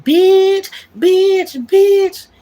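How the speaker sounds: repeated bitch, yelled high and loud in mockery; mocking name-callers. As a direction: mimic people hurling bitch at her, loud and high. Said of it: "they was calling me".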